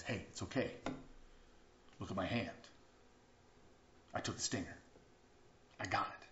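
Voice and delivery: a soft, calming dad's voice, quiet; gentle reassuring father. Retold or imitated. Father calming his scared son in the car, softly reassuring. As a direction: soothe a frightened boy softly.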